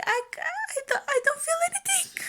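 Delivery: very high, squealed helpless complaint; mock panicked protest. Numb mother answering the birth team, high mock panic. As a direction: act out your own panic, comically high. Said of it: "I was like".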